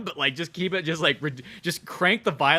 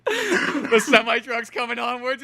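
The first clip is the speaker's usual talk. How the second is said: ordinary delivery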